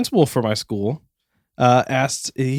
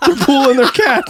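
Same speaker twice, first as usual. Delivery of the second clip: panicked realization; 12 semitones higher, 4 dB louder than usual. Retold or imitated. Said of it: "And I'm like".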